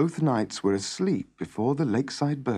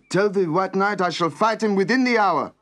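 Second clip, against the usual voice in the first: raised, higher, louder declaration; declaring boldly; 8 semitones higher, 5 dB louder than usual. A narrator's reading. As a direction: announce it like a knight accepting combat. Knight calls out his challenge acceptance.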